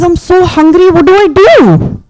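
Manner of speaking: high-voiced mock desperation; hungry Anansi. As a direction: whine it like a starving story character. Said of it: "his tummy is really rumbling".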